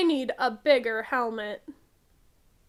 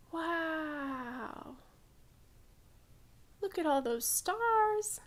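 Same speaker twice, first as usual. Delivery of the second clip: soft, breathy wonder at the stars; hushed awe; 11 dB quieter, much slower than usual. A narrator's reading. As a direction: whisper the wonder of the night sky. Awe at the starry sky.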